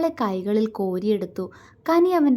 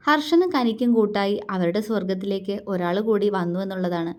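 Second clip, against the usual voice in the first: ordinary talk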